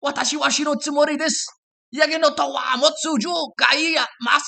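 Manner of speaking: fast, high, loud excited Japanese; Japanese man. Acted. Stranded Japanese man greeting the goat, high fast excited. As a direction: blurt it out in Japanese. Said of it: "the man said".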